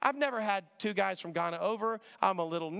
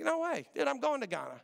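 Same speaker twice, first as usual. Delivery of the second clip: surprised; 7 semitones higher than usual. Retold or imitated. Amazed at the coincidence.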